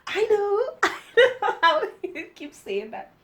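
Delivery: high, loud, through laughter; laughing aside. Real, not acted. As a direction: crack up while talking. Laughing aside about own repetition.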